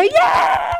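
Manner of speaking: put-on high cheering whoop at a toddler's fall; proud parent whooping at a first step; excited cheer. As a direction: cheer the baby on like it just walked. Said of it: "you go".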